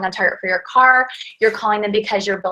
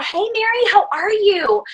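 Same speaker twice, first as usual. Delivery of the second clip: high, lifted hello; excited greeting; 10 semitones higher than usual. Shown as a demonstration. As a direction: sing out the greeting, pitch way up. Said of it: "there always has to be that".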